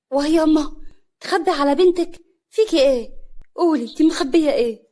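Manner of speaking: high, loud, urgent questions; insistent pressing. Acted. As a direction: press the mother hard for the truth. Daughter presses mother for secret. (بإلحاح وعتاب)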